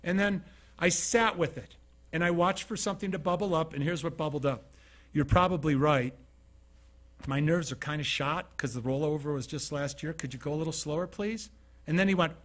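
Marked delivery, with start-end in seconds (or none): 5.1-11.5 soft, low and soothing; calm him down softly; calm, gentle reply. Retold or imitated. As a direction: speaker answers the angry driver kindly and quietly. Calm reply to rude driver.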